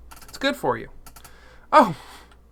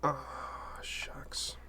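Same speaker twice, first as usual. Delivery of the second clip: whispered; 2 semitones lower, 16 dB quieter than usual. Real, not acted. Quiet disappointment at a miss.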